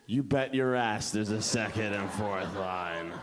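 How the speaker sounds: low, slow, drawn-out assurance; cocky pitch. Retold or imitated. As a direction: drop low and stretch it confidently. Confident songwriter answers the room.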